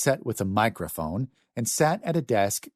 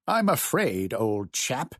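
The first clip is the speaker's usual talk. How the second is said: posh British accent, exaggerated; 3 semitones higher, slower than usual. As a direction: play it thick and British. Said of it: "using a thick British accent".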